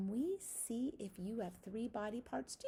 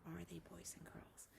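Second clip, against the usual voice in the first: whisper; 4 semitones lower, 13 dB quieter, faster than usual. Acted. Teacher aside to children.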